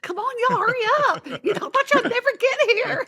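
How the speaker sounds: very high, loud eager teacher voice with a laugh; excited teacher. Retold or imitated. Teacher urging first graders to hurry to the science experiment, excited. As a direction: hurry the kids along, bursting with excitement. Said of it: "I'm like".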